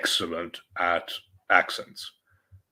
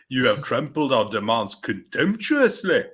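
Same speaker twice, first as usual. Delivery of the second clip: Ulster orator impression, higher pitched; William Ulsterman; 4 semitones higher than usual. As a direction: put on an Ulster accent and declaim indignantly. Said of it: "Harry Enfield did, like, William Ulsterman".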